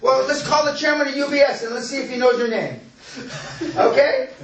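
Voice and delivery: high, laughing mock challenge; laughing tease. Real, not acted.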